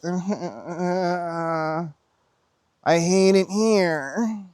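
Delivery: drawn-out, put-on whine rising high; mock whining. Mocking people who give up, in an exaggerated whine. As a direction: imitate a complainer moaning and wailing. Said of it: "Whiny bitch".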